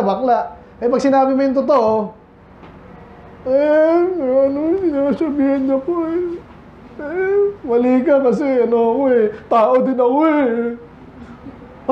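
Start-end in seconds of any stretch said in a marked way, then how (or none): 3.5-11.9 higher, put-on whiny voice, dragged out at the end; a mocking whine of "I'm only human"; whiny self-pity. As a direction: play the misunderstood victim. Imitating self-pitying sinner.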